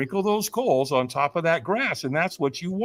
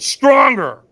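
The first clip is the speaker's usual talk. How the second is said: high, loud acted trainer's push; forceful demand; 8 semitones higher, 12 dB louder than usual. Trainer pushes speaker to say yes, forceful shout.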